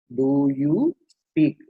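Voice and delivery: "Do you speak" drawn out very slowly, word by word. Shown as a demonstration. Teacher showing students the question's word order, slow and segmented. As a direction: separate Do, you and speak with gaps. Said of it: "முதல்ல do வரும், அப்புறம் you வரும்".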